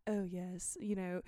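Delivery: badly faked upper-class British; terrible British accent. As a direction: put on a posh British accent, quietly. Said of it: "do a terrible British accent".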